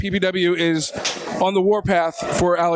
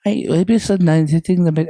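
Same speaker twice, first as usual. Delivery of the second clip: mild mimic of a German-ish accent; imitating the accent. Host mocking his cohost's German-Croatian accent. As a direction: parody his partner's put-on accent. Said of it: "trying to be German".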